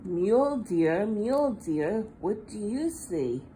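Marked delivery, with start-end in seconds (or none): none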